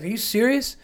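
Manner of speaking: high, stunned disbelief; incredulous boyfriend. Retold or imitated. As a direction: ask it like your world just collapsed. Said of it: "And he's like".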